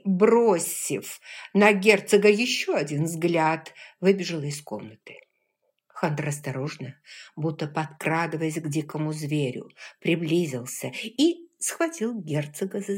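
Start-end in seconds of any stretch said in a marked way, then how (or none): none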